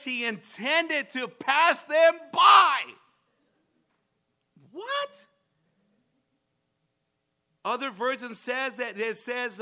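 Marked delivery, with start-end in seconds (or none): 0.0-2.8 slow, high, loud astonished cry; amazed shout. Real, not acted. Preacher shouting his surprise at the congregation. 4.7-5.3 very high startled cry; stunned what. Real, not acted. Preacher reacting to the verse in disbelief.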